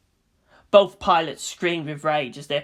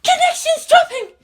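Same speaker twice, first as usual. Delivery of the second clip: very high, louder panicked report; alarmed technician; 8 dB louder than usual. A narrator's reading. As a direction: shout the alarm.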